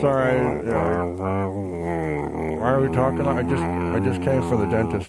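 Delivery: talking through a toothbrush; while brushing teeth. Real, not acted. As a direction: mouth full of toothpaste. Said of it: "Sorry, brushing my teeth".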